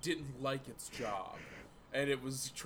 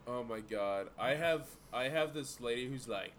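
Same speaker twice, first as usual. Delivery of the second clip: usual speaking voice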